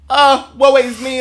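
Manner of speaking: high, slow tragic lament; hammed-up woe is me; melodramatic Ophelia. Shown as a demonstration. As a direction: swoon it out like a tragic heroine. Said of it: "very much like Ophelia".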